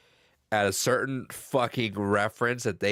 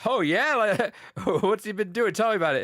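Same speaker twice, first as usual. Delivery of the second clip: excited Hank; 10 semitones higher than usual. Retold or imitated. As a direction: lean in, thrilled to hear it. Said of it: "Hank gets all excited".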